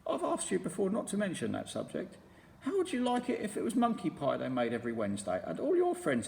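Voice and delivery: a wounded little complaint; roly-poly bird voice. A narrator's reading. Roly-poly bird scolding Mugglewump, mildly hurt.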